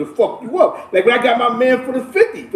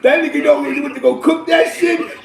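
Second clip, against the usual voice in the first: normal talking voice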